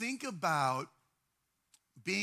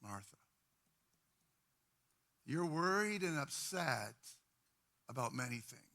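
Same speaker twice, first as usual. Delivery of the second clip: calm her down tenderly; gentle Jesus; 5 semitones lower, 4 dB quieter than usual. Retold or imitated. Jesus answers anxious Martha, soft and gentle. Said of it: "And Jesus responds".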